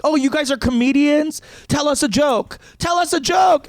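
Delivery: high-pitched, pushy mock demand; mocking drunk hecklers. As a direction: imitate an annoying excited stranger. Voicing drunk strangers demanding jokes.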